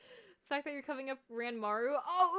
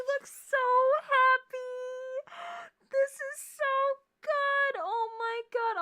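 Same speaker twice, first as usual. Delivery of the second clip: higher, louder, then a teary gasp and slow gush; overwhelmed squeal; 11 semitones higher, 7 dB louder, slower than usual. Real, not acted. Streamer sees the character smile, high teary squeal. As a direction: melt over how happy he looks.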